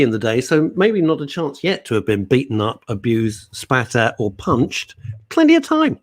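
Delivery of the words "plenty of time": very high, loud comic squeak; high-pitched comic aside; squeaky joke voice. Acted. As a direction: squeal the punchline. Joke about being beaten up.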